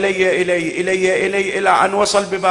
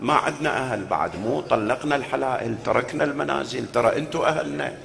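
softer, lower explaining aside; 3 semitones lower, 7 dB quieter than usual. Real, not acted. Preacher explaining the line softly to the mourners. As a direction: hush and explain to the crowd.

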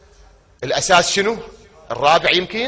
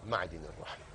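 soft echo; 9 semitones lower, 16 dB quieter than usual. Acted. Phrase repeated very softly.

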